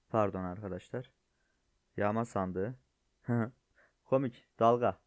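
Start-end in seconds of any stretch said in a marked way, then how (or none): 3.3-5.0 loud, delighted burst after a laugh; amused outburst. Real, not acted. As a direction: blurt it out laughing at the joke. Laughing at a funny crate design.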